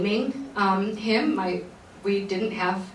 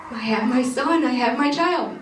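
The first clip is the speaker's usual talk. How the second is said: higher and louder, choked with happy tears; emotional announcement; 6 semitones higher, 4 dB louder than usual. Retold or imitated. New mother telling her mom about her son, tearful and elated. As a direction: burst out the news to your mom. Calling mom after sobbing.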